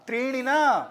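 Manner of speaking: ordinary talk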